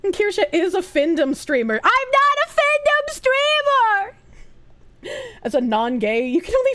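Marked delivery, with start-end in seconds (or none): 1.8-4.1 indignant denial. Real, not acted. Streamer rejects chat's label, indignant and high. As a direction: squeal out the denial, offended. Denies chat's joke about her.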